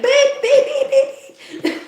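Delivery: high, loud beeping like a censor bleep; mock censor bleeps. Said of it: "Instead of just being like".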